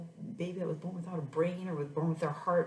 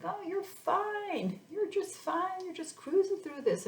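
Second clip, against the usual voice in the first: mocking breezy doctors; 13 semitones higher, slower than usual. Mocking her doctors' breezy reassurance.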